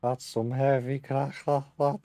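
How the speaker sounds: put-on Liverpool accent, chuckling through it; lightly mimicking a Scouse fighter; Paddy the Baddy. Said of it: "is that who you gonna be?".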